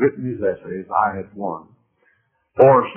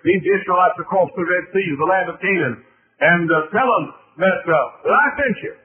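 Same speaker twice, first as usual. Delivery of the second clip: preaching; 8 semitones higher than usual. Acted. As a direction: tell the scripture at full force. Preaching the Moses story.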